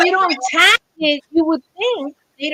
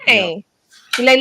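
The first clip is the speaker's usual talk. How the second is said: ordinary delivery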